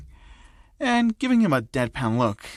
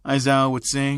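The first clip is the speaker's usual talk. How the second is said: plain reading voice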